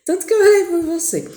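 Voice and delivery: laughing through a high, joyful line. Retold or imitated. Husband rejoices that his wife feels better, laughing. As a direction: let relief spill out as laughter.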